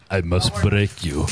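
slow, low menacing boxer threat; deep Drago-style threat; Dolph Lundgren impression. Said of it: "Your Dolph Lundgren impression".

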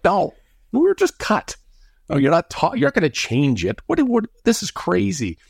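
high, incredulous protest; exasperated disbelief. Retold or imitated. Player telling the coach they were just cut, high incredulous protest. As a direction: throw up your hands at the absurdity.

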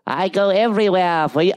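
high-pitched garden gnome character voice; Travelocity Gnome. Acted. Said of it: "for the Travelocity Gnome".

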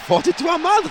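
incredulous echo. Real, not acted. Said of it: "That sounds like a street name".

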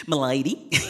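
bow and say it in a mock British Southern drawl; a hammed-up British Southern m'lady; British Southern accent. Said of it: "made up some random British Southern accent".

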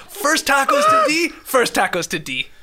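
put-on quoting Jake's odd accent again to show how he sounded; Jake's accent imitation. Mocking Jake's odd accent a second time while laughing. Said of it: "that was the worst accent ever".

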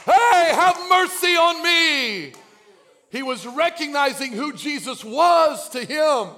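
shouted preaching, high and loud; preaching at full force. Acted. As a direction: preach it out with fire.

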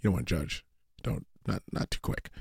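hesitate, then fade almost to nothing; hesitant backpedalling. Retold or imitated. Dad fumbling an answer to his son, quiet and hesitant. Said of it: "And I was like".

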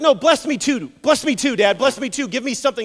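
high, loud insistent begging; pleading. Acted. Esau begging his dad for a blessing, pleading. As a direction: plead urgently, repeating the demand.

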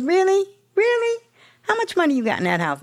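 a sponsor calling her bluff, shooting up in pitch; sponsor's voice. Retold or imitated.

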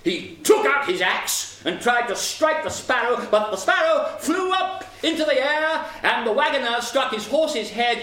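high, energetic action telling; excited narration. A narrator's reading. Narrator telling of axe swung at sparrow, excited. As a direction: drive the action up in pitch.

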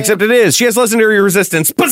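high, loud, fast gloat. Real, not acted. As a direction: rub it in gleefully. GM gloats about legendary resistance.

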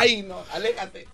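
soft, slow, pleading put-on voice. Comedian begs her to stay away, soft and slow. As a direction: shrink away with a soft whine. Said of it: "con esa voz".

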